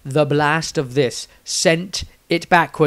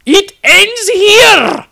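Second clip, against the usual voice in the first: high, loud, drawn-out war cry; battle cry; 10 dB louder, much slower than usual. A narrator's reading. Sister charging the beast man with her hammer, slow high war cry. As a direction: hurl it as you charge. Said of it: "cried the plump woman".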